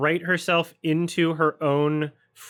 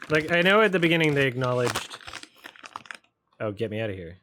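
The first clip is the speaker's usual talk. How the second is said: ordinary talk, nothing marked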